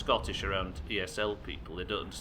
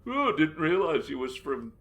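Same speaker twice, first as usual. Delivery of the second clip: high, loud put-on surprise; mock clueless joker; 7 semitones higher, 6 dB louder than usual. Somebody mocking a Canadian accent attempt, fake amazement. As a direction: mimic the basic joke in a raised voice. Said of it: "somebody else says".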